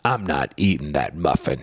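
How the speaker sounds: put-on low, muttered tough-kid line; mild tough-guy growl from a kid; little criminal. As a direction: sound like a sullen little crook. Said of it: "he says it kinda like a little, like, criminal".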